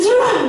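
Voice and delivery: a quick sound effect of grabbing; mock snatch noise. Parent grabbing the child back from grandma, sharp mock sound.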